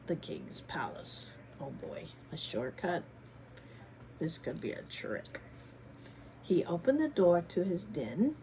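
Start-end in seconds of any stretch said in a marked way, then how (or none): 0.1-5.4 hushed. Acted. Suspenseful hushed reading.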